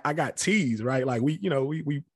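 usual speaking voice

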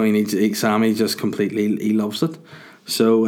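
ordinary delivery

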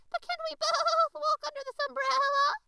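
very high, loud bleating goat lady. A narrator's reading. The goat lady thanks the rabbit, bleating loud and high. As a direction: bleat it out like an excited nanny goat. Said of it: "bleated Auntie Letty".

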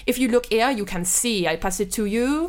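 hammed-up French waiter accent. Waiter serving a diner arsenic cocktails, French accent. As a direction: play a fussy French waiter, lilting.

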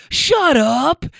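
thrilled, can't believe it; excited disbelief. Retold or imitated. Rider hearing about the podcast, thrilled.

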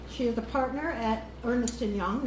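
ordinary talk

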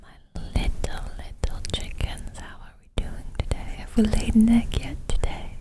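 put-on breathy old granny whisper to chickens; grandma whispering. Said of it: "grandma's just whispering".

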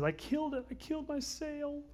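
high, strained cry of mock grief; anguished wail. Salesman mourning the torn bread roll, high comic wail. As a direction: wail over the loss like a comic heartbreak. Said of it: "He says".

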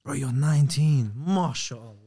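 ordinary delivery

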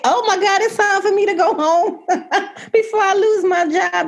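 high, laughing mock panic; giddy high-pitched laughing retelling. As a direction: squeal it through laughter. Retelling her own panicked reaction.

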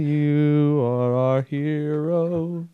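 high, slow, loud hero call; loud, drawn-out high tribute call. Pretend. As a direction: proclaim it to the heavens. Calling out to SoundCloud.

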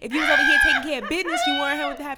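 loud, high, rapid-fire mock shouting; mock angry retort. Fan re-enacting a woman's comeback in a song intro, hammed-up yelling. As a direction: shout it fast through laughter.

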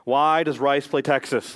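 emphatic presidential oration; deliberate, projected speech with weighty pauses; JFK. Acted. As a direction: declaim it slowly like a political address. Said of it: "he says this to them".

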